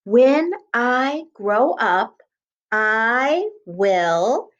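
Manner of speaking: very slow, word by word; slow dictation. Shown as a demonstration. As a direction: dictate it with space between words. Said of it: "start by writing at the top".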